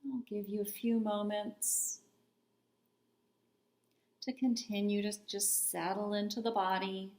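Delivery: unhurried meditation voice with long pauses between phrases; calm, spacious meditation guidance; guided body scan meditation. Real, not acted. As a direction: guide them gently into stillness, leaving space after each phrase.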